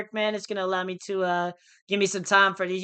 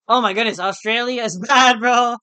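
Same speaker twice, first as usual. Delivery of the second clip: laughing exclaim; 3 semitones higher, 8 dB louder than usual. Real, not acted. Laughing exclaim about Australia.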